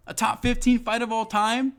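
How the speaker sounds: high, rising incredulous question; indignant disbelief. Real, not acted. Fan reminding viewers of a great fight, indignant disbelief. Indignant, before shouting it again.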